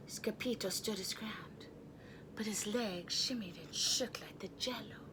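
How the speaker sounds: tense unvoiced whisper, soft. A narrator's reading. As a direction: build suspense in a hush. Tense moment.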